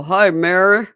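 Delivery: loud mock-dad hello; hearty greeting. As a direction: call out a big hello, imitating Dad. Imitating dad's cheery greeting.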